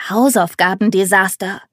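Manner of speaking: plain reading voice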